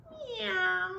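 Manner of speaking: high drawn-out meow; cat meow. Acted. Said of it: "Cat said".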